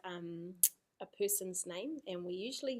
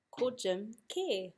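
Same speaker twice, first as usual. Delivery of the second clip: slow correction; slower than usual. Shown as a demonstration. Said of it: "mā te reo teina".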